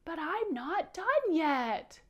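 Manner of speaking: high, loud, drawn-out pouty whine; upset whine. Pretend. Powder told to clean up mid-play, whining in protest. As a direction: whine it slowly like an upset bug puppet. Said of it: "he would get so upset and say".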